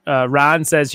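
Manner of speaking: usual speaking voice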